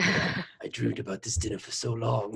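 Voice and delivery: soft, husky smoker's voice; smoker's rasp. Retold or imitated. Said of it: "He just gets Smoker's voice".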